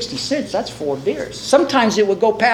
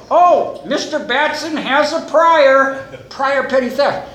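higher, slow announcement of a discovery; mock surprise; 6 semitones higher, slower than usual. Court discovering the defendant's prior theft, mock-surprised. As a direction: draw it out as a pointed revelation. Court reveals his earlier conviction.

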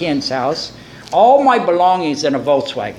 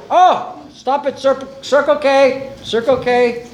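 higher-pitched excited call to stop; sudden urging; 6 semitones higher, slower than usual. Acted. Buddies to driver passing a store, suddenly urging.

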